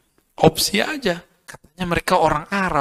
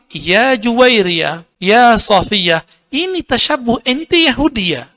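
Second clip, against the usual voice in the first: imagined Prophet's words; 5 semitones higher, 3 dB louder, slower than usual. Retold or imitated. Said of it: "tentu Nabi bilang".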